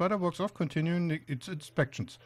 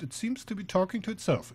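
ordinary talk, nothing marked

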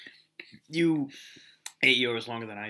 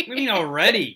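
high, loud protest; protest, caught off guard; indignant surprise; 8 semitones higher, 8 dB louder, faster than usual. Real, not acted. Protesting partner's teasing.

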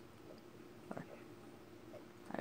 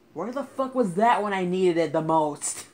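angry shout; 6 semitones higher, 13 dB louder than usual. Real, not acted. Angry at a missed rescue item.

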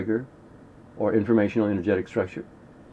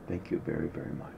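breathy whisper, much softer; whispered thanks; 6 semitones lower, 15 dB quieter, faster than usual. Shown as a demonstration. Teacher ending the prayer to confidence in a whisper. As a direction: whisper your thanks intimately. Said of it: "But, but you do it like this".

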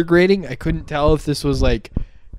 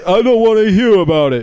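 high, louder mock chief yell; hammed-up furious chief; mock police chief; 8 semitones higher, 9 dB louder than usual. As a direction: roar the order like a cartoon boss.

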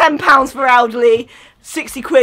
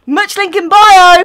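loud slow plug; 4 semitones higher, 9 dB louder than usual. Pretend. Plugging merch loudly.

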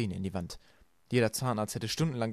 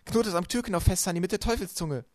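higher, louder eager instructions; excited; 7 semitones higher, 4 dB louder than usual. A narrator's reading. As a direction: urge her on breathlessly. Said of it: "sagte Violet aufgeregt". (aufgeregt und drängend)

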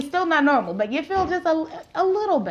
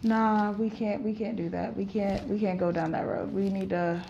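softer, self-soothing inner voice, slowing to the end; calming self-talk; 3 semitones lower, 7 dB quieter than usual. Retold or imitated. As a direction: talk yourself down gently. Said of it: "But in my head, I'm just like".

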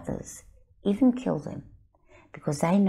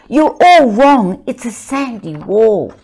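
put-on angry child; 5 semitones higher, 15 dB louder than usual. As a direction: shout them all down. Children arguing, everyone angry.

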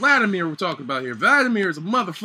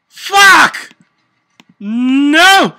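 frustrated yell; 6 semitones higher, 10 dB louder than usual. Real, not acted. Panicking in a ranked game.

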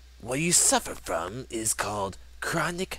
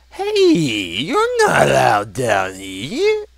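a nervous guard, voice pitched up and wavering, slow; shaky guard voice; 7 dB louder, slower than usual. Acted. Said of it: "the guard says in a shaky voice".

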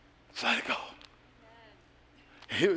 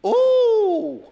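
sudden high loud ooh; high excited ooh; 6 dB louder than usual. Real, not acted. Preacher reacting to his own point with a high ooh. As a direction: yelp it up high.

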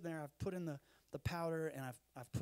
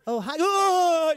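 high, very loud frightened yelp; hammed-up scared scream at a creepy child; startled shriek; 14 semitones higher, 22 dB louder, much slower than usual. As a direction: jump out of your skin mid-hello. Startled by daughter at 4am.

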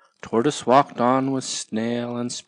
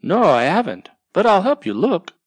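louder, higher, cheerful little snail; Snail helping; 7 semitones higher, 7 dB louder, faster than usual. Acted. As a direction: offer help brightly. Snail offers help.

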